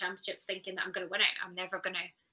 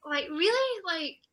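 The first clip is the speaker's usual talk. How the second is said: disbelief; 9 semitones higher, 6 dB louder, much slower than usual. Real, not acted. Disbelief after winning.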